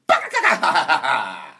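loud cackling laugh like a witch; mock witch cackle; witchy sleep laugh. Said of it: "laughs like a witch in their sleep".

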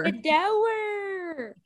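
"door" very drawn out and high, like "DOH-werrr"; Southern two-syllable pronunciation. Retold or imitated. Echoing friend's childhood word, "door" as high Southern "DOH-wer". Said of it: "not a door, a door".

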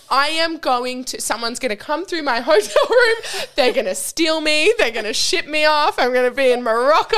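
hammed-up hotel panic; mock panic. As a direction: play up the paranoid traveller. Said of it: "I was like".

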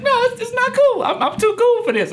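very high, comic tearful whine; mock crying protest. Guest telling therapist crying is uncool, mock-tearful. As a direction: protest through hammed-up sobs.

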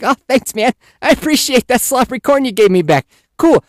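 put-on high, playful thanks to a toddler; high-pitched, overdone appreciation; mock gratitude. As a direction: thank a little kid with put-on cheer.

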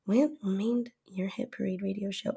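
whispering. Real, not acted. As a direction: drop to a whisper. Same words repeated in a whisper.

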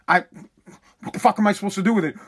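ordinary delivery

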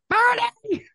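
high, loud hammed-up yell; piercingly high mock summons; mock holler. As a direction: holler his name like a nagging complainer. Mock Marge hollering for Bernie.

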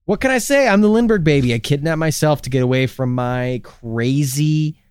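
normal talking voice

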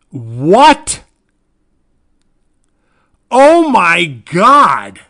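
loud high shock, words drawn out; can't believe what he just read. Real, not acted. Vlogger reacting loudly on discovering the actress's age, shocked.

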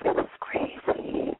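slow, hushed whisper so no one hears. Real, not acted. Host whispering from a stranger's bedroom, slow hush. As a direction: breathe the words out, keeping it secret.

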